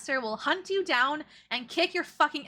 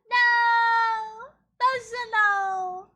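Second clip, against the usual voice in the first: higher and louder mock yell at the snow; retold scream; 11 semitones higher, 7 dB louder, much slower than usual. Looking out her window at the snow, mock screaming. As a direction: shout it like a kid seeing snow outside. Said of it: "screaming across the room".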